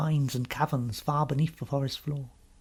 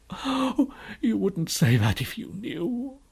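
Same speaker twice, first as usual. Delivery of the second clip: slow, high, woeful lament; tearful faun; 7 semitones higher, slower than usual. Acted. Faun answering Lucy's comfort, tearfully. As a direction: wail it slowly in self-reproach. Faun wailing in self-reproach.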